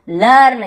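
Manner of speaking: लर्न loud and high, open vowel; correct reading लर्न. Shown as a demonstration. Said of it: "यहाँ पर भी लिर्न या लेर्न नहीं पढ़े". (लर्न का ज़ोरदार सही उच्चारण)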